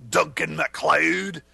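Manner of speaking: slow, loud, high dramatic Scottish brogue; Scottish accent. Shown as a demonstration. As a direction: deliver it like a grand Highlander rebuke, slowly. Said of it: "that's my new Scottish line".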